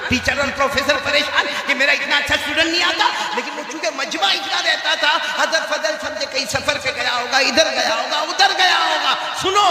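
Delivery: high, driving sermon delivery; impassioned preaching. Real, not acted. Preacher telling congregation of a missing student, impassioned.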